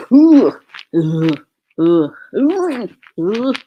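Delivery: loud retching gags; real gagging, loud ugh sounds. Real, not acted. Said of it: "Sorry, I'm gagging".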